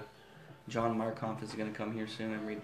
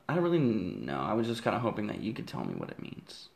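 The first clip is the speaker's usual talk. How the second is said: normal talking voice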